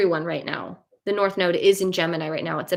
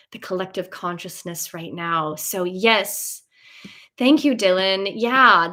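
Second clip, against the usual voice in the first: usual speaking voice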